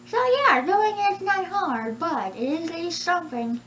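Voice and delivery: very high, cartoonish dinosaur voice; Yoshi voice. Shown as a demonstration. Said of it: "this is how I sound like Yoshi".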